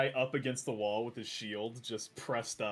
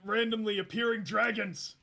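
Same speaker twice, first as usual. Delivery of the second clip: grand title announcement; 7 semitones higher, 4 dB louder than usual. Acted. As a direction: proclaim a book title with pomp. Character announces book title.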